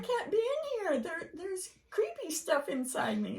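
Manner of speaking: high, fast mock panic. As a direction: squeal it like a spooked kid. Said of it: "It's like".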